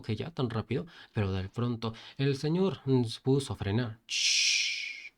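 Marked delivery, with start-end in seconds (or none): 4.1-5.1 hush. Acted. Driver brakes, hushes.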